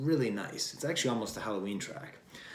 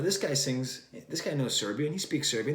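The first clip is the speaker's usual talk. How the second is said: ordinary talk, nothing marked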